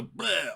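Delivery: loud, high comic retch; mock vomit noise. Imitating the AI dumping another wall of text, mock vomit. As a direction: blurt a big "bleh" as if throwing up. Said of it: "like, throwing up all these lines".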